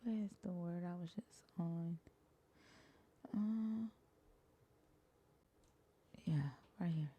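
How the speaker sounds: very soft, half-whispered search; muttering to oneself. Real, not acted. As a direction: murmur it privately while hunting for the place. Searching text, muttering to self.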